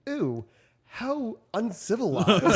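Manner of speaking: drawn-out, rising mock disgust; exaggerated scandalized reaction; mock disdain. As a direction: play up the horror, slow and high. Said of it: "I'm just like".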